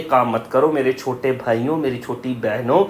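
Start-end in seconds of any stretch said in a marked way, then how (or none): none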